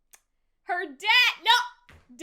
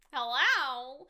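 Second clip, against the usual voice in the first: usual speaking voice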